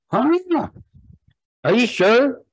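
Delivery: high, loud disbelieving huh; stunned huh. Acted. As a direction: squeak out shocked disbelief. Suitor stunned by rejection.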